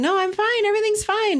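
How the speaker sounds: high, falsely bright "I'm fine"; mock fine. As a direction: chirp it to hide that anything's wrong. Wife hiding behind her mask.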